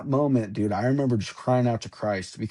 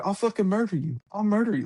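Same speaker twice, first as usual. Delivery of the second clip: retold threat; 8 semitones higher, faster than usual. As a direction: imitate your own panicked threat, pitched high. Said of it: "I was like".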